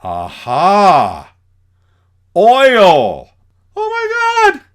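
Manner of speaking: loud, high cry of joy at finding oil; hammed-up yelp of delight at oil; excited discovery. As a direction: shout out the discovery.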